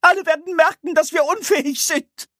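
cartoon crybaby. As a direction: wail in panic. Angsthase continues wailing.

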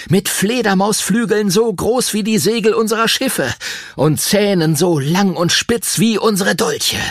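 dramatic campfire storyteller. Acted. (dramatischer Geschichtenerzähler am Lagerfeuer, mit hoher Stimme)

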